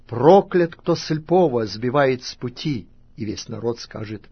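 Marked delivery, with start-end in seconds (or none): 0.1-2.9 raised pronouncement, hitting the first word hard; loud proclamation. A narrator's reading. Levites pronouncing a curse to all Israelites, loud proclamation. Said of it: "скажут всем израильтянам громким голосом".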